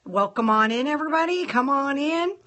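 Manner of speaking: ordinary talk, nothing marked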